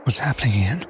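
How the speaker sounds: low, whispery question; hushed séance voice. Acted. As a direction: ask under your breath during a séance.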